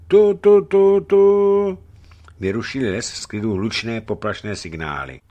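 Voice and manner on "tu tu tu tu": high, loud imitated horn blasts; voiced horn signal; horn call imitation. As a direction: blare it like a war horn. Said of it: "zvedl jej ke rtům".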